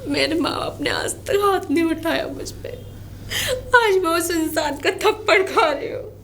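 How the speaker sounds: tearful, wounded protest; sobbing complaint; crying. Acted. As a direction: plead your case through sobs. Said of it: "अब रो मत".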